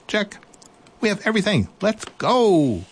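high, bright little mouse voice; eager little mouse. Acted.

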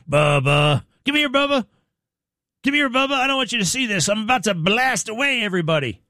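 mocking Alex's call. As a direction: ham up the sweet call to the dog. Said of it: "That's Alex twice calling for her".

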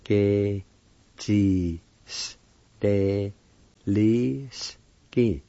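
"Ke Jis Te Li Ski" broken by pauses; syllable-by-syllable pronunciation. Shown as a demonstration. Teacher demonstrating for learners, "Ke Jis Te Li Ski" one syllable at a time. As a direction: sound out "Ke Jis Te Li Ski" piece by piece. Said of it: "it's pronounced like this".

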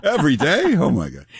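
high, laughing "Every day?" with a rise; laughing disbelief. Real, not acted. Hearing the podcast is daily.

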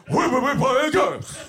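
put-on comic voice. Comedian ranting to the crowd about women playing games, put-on voice. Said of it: "I do not talk like that at all".

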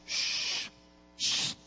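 unvoiced shh to calm a horse; breathy hush like soothing an animal; soft shushing hiss. Shown as a demonstration. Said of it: "haciéndole shh".

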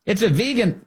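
"vegan" as VEE-gun, high-pitched; high, insistent long ee in "vegan"; correct pronunciation. Shown as a demonstration. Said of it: "how to pronounce that thing".

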